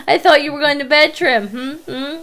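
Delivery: loud, nagging scold; hammed-up scolding Trim. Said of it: "Trim's like".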